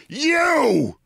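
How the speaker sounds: high, drawn-out cry of accusation; shout. Retold or imitated. Storyteller accusing his colleague on suddenly remembering, high retold shout. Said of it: "I shout at Bill Maher".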